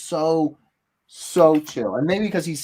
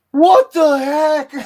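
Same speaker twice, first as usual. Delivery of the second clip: much higher and louder, slow and laughing; shocked outburst; 14 semitones higher, 12 dB louder, much slower than usual. Retold or imitated. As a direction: blurt it out in laughing disbelief. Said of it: "you're like".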